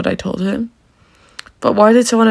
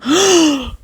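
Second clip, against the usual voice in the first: gasp; 8 semitones higher than usual. Acted. Shocked reaction to theft news.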